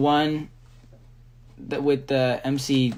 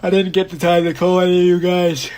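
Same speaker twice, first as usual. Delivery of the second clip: high, loud regretful outburst; dismayed and loud at running out of time; 4 semitones higher, 9 dB louder than usual. Real, not acted. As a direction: exclaim it, then a big sigh. Realizes no time left to call fans.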